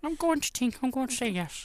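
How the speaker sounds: hammed-up attempt at Irish; bad Irish accent. Presenter joking with co-host, terrible Irish accent. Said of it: "worst Irish accent".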